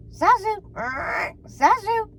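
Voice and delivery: high squawking parrot call. A narrator's reading. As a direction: screech it like a parrot. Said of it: "the bird chirped".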